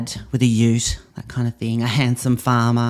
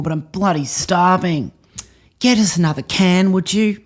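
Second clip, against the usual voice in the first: typical Aussie male; 5 semitones higher than usual. Pretend. Actor doing an improv of an Aussie bloke nagging his girlfriend. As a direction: play a demanding lout on the couch. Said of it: "take on the role of a typical Aussie male".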